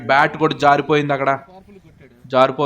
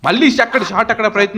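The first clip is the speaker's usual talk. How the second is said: excited; 8 semitones higher, 4 dB louder than usual. Real, not acted. Another big shot is attempted.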